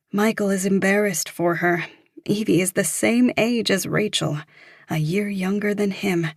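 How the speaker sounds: plain reading voice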